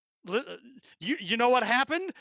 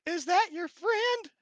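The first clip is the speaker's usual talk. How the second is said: high, slow, stunned question; stunned old lady; 10 semitones higher, slower than usual. Acted. Old lady sees giant carrying deer, high stunned voice. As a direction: ask it slowly, pitched way up in disbelief.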